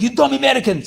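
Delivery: loud, high furious foreign professor; mock angry professor yelling at students; Ukrainian professor. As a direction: bark it like an angry Ukrainian teacher. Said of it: "He's like".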